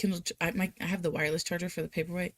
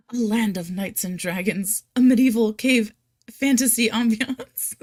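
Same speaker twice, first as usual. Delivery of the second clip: giggling through the title, getting louder at the end; laughing; 2 semitones higher, 7 dB louder than usual. Real, not acted. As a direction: laugh out the silly title, louder on the last words.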